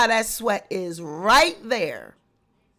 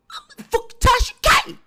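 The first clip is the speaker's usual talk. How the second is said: I'm a fucking Tasha K, much higher and loud; shrill boast; 12 semitones higher, 4 dB louder, faster than usual. Retold or imitated. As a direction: squeal the boast way up high. Same boast said twice.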